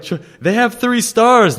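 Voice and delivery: a young cook squeaking in mock outrage; indignant protest. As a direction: pitch it up as if outraged at their ignorance. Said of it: "I was like".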